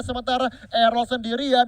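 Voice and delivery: normal talking voice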